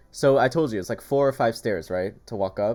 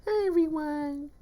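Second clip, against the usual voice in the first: much higher, slow squeaky dog voice; voicing the dog. Owner voicing her dog greeting the viewers. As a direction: speak for the dog in a tiny voice.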